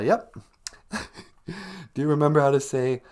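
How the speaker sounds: normal talking voice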